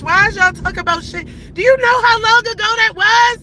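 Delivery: loud, high-pitched indignant demand; exasperated outburst. Real, not acted. Challenging online harassers over an old incident, real angry outburst. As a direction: fire the questions out in real outrage.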